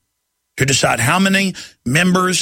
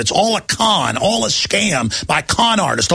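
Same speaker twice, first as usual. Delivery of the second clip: ordinary talk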